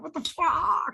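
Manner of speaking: very high incredulous squeal; exasperated disbelief. Retold or imitated. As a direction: let it jump up in disbelief. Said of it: "It's just like".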